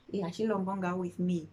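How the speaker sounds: ordinary delivery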